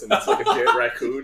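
telling friends the raccoon twist, laughing; laughing punchline. Real, not acted. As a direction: crack up while delivering the punchline. Punchline told while laughing.

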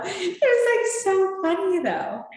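high, laughing delivery. Real, not acted. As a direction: laugh through the words. Laughing at own story.

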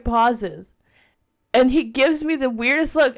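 usual speaking voice

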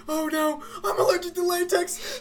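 high panicked pleading with crying laugh; mock allergic panic. Allergic man pleads in hammed-up panic. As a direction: whine like a doomed horror victim. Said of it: "everybody goes".

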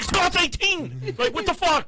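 high, drawn-out squawk of outrage; indignant protest. Retold or imitated.

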